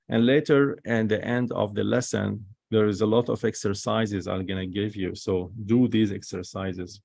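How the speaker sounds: ordinary delivery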